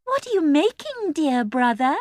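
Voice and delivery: high sweet cooing voice. A narrator's reading. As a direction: coo it sweetly at brother. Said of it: "in a sweet, cooing voice".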